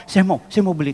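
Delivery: like someone desperate to buy; urgent eagerness. Retold or imitated. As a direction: imitate an impatient buyer. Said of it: "Kayak orang kebelet".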